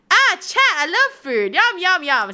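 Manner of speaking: high, loud, slow food-lover gush; gushing over food. Pretend. To chat, showing off the super blush with loud happy gushing. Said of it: "The super blush is like".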